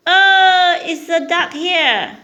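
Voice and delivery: loud, slow, excited pointing out. Real, not acted. Parent showing toddler picture book.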